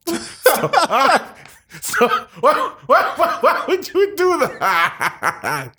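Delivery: high squeals of laughter; laughing through the words; laughing hard. Real, not acted. Breaking into laughter.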